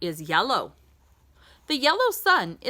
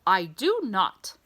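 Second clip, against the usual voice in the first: usual speaking voice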